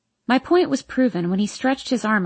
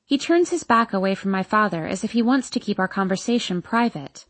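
ordinary delivery, nothing marked

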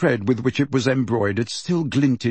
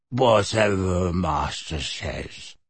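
low, slow servile elf; 4 semitones lower, much slower than usual. A narrator's reading. The elf answering Sirius, low and slow. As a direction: croak it out slowly like a bowing elf.

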